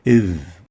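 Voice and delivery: Iv said deep, with the tongue tip at the upper teeth; a heavy, throaty "Iv"; ظ مفخمة; emphatic Arabic ظ. Shown as a demonstration. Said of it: "Tip of the tongue and root".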